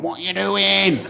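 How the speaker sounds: a light Manchester swagger on the question; lazy Manc drawl, lightly done. Retold or imitated.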